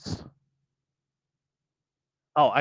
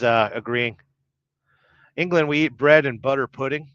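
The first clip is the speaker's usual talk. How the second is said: ordinary delivery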